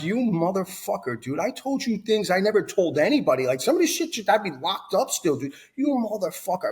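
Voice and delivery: retold fury. Yelling at the sponsor who burns his fifth step, high and heated. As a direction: spit it out like betrayal all over again. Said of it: "And I got fucking furious".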